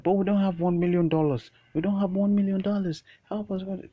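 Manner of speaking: high, loud desperate plea; pleading secretary. Acted. Secretary begging the villain, high frantic plea. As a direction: beg him, voice way up and loud. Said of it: "Be like".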